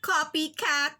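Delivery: mocking classmate teasing; sneering. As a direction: sneer it at her like a taunt. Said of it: "said Nathalie with a sneer".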